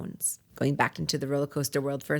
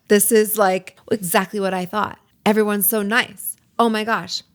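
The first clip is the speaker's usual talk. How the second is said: louder, bubbly rush of delight; excited gush; 4 semitones higher, 6 dB louder than usual. Retold or imitated. New student thrilled by the class, excited gushing. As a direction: gush like it's a dream come true.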